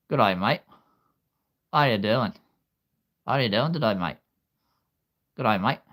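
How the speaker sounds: cheery Australian greeting; Australian accent. Shown as a demonstration. Trying out accents for listeners, Australian accent. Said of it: "my Australian accent".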